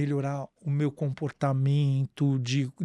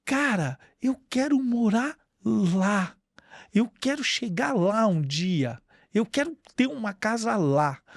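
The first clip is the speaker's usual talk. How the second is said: retold longing; 5 semitones higher than usual. Young local gazing at the rich neighbourhood, retold longing. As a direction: voice the dreamer, stretching the words with longing. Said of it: "Então você olhava aquilo e você falava".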